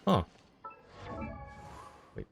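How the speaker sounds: ordinary talk, nothing marked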